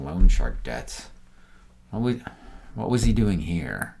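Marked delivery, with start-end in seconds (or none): none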